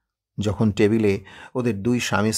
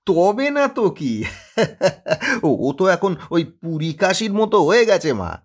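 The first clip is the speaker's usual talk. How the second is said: laughing reassurance; 9 semitones higher, 5 dB louder than usual. Acted. Son laughing off mother's worry.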